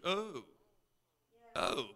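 soft, baffled oh; mock bewilderment; confused. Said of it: "and we're confused".

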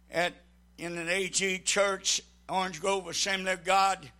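normal talking voice

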